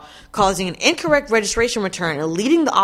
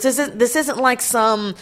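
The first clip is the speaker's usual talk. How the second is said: normal talking voice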